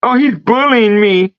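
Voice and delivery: a high, whiny mock victim voice; mock whine. Mocking a soft complainer.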